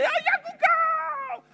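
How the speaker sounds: high, excited cheer of a proud parent. Retold or imitated. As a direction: call out like a parent by the field. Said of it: "scream the loudest".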